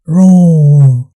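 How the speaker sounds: low, loud lion roar. Shown as a demonstration. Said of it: "How lion says?".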